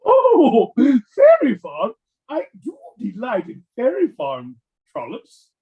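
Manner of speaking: high, swooping, hammed-up British delight; affected British dandy. Young George gushing over his farm, affected British. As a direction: coo it in a put-on British falsetto. Mock posh British character reacting.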